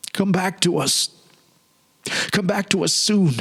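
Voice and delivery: put-on high, child-like calling voice, far off; high, lilting call of children beckoning; playfellows calling. A narrator's reading. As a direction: call sweetly from a distance like playmates. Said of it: "my dear playfellows who had called after me".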